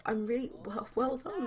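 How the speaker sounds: high-pitched acted congratulations; gushing praise. Nicole praising Jamie's business opportunity, gushing. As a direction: lift it high for a well-wisher.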